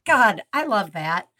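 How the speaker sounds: usual speaking voice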